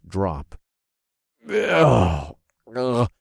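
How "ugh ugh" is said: high, loud "ugh" of disgust; disgusted groan. Acted. Potion drinker reacting to the bitter brew, disgusted groans. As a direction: gag at a foul taste.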